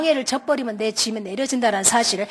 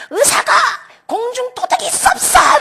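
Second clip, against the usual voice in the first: angry yelling; 11 semitones higher, 5 dB louder than usual. Retold or imitated. Parent berating the doctor before everyone, high angry yelling. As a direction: re-enact an outraged public complaint. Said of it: "막 소리를 치면서 갔어요".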